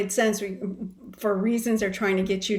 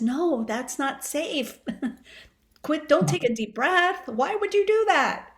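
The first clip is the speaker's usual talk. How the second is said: body's warning voice; 6 semitones higher than usual. Acted. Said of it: "Your body says".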